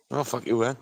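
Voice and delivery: ordinary delivery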